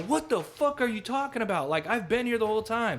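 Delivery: high-pitched mock bewilderment; retold indignant reply. Retelling her answer to the panicked man, indignant mock protest.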